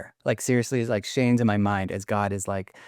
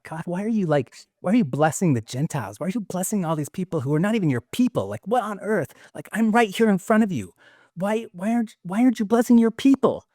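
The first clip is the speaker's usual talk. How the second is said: higher pitched, exasperated pleading at God; frustrated Peter; 7 semitones higher than usual. Retold or imitated. Host voicing Peter complaining to God, frustrated and higher. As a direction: Peter rising in pitch as his frustration spills over. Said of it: "He's feeling so frustrated".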